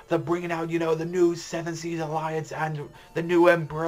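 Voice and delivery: high, ringing herald's cry; screaming proclamation. Retold or imitated. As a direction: announce the execution to the crowd. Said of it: "like, you know".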